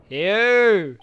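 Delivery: loud glad cry of the name; excited shout. Real, not acted. Player cheering as Hugh shows up, loud. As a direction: call out happily as he arrives. Character appears.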